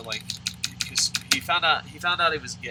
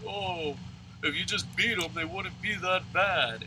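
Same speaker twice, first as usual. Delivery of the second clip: higher, drawn-out mock complaint; mocking imitation; 6 semitones higher than usual. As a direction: parrot a naive opinion in a higher voice. Mocking a meme's opinion.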